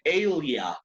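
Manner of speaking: ALIA said as "AY-lia"; English EI-lia ending. Shown as a demonstration. Teacher gives a hint for Australia, ALIA as "AY-lia". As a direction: open ALIA on the English long A. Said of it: "La segunda parte se dice alia".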